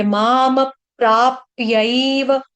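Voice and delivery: माम closed onto a half प before प्राप्यैव; माम प्राप्यैव with a doubled p at the join; half-consonant join. Shown as a demonstration. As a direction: link माम प्राप्यैव as maamap-praapyaiva. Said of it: "माम के बाद आधा प लगाएंगे".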